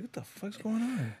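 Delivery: very high, soft put-on voice; pretend chipmunk squeak. Joking along about chipmunks, high and small. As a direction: squeak it out softly, playing a little creature.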